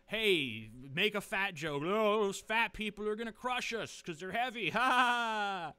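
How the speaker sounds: hammed-up hack joke with fake laugh; mocking average joke. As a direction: ham it up like a lame comedian. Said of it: "Anybody, like".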